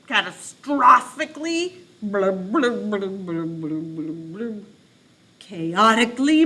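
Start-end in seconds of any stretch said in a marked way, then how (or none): 2.0-4.7 bubbly blums fading out; underwater blubbing, fading. Shown as a demonstration. Teacher reads underwater, blums fading. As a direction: let the blubbing sink away. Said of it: "pretend to be underwater".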